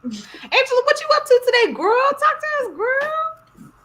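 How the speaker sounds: high, loud calling out. Real, not acted. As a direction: call her in, loud and high. Calling out to Angela online.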